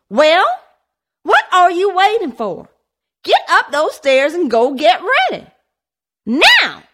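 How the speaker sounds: high, loud, railing squawk; squawking rant. A narrator's reading. Mother railing at children staring, loud squawk. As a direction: shriek them up the stairs. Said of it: "she squawked".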